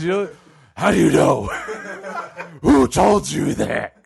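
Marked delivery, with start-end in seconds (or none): 0.8-1.5 rough, demonic growl; Satan-sounding God. Acted. God challenges the doubting man, demonic growl. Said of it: "that's how Satan sounds". 2.6-4.0 slow demonic growl; Satan-sounding God. Acted. God questions the doubting man, slow demonic growl. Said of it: "that's how Satan sounds".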